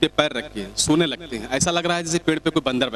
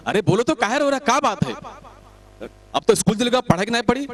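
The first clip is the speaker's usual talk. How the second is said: pressing friend; 7 semitones higher than usual. Acted. Friend pressing crying boy.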